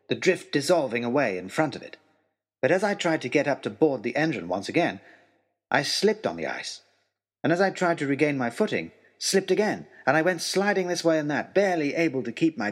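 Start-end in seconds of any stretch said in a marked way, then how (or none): none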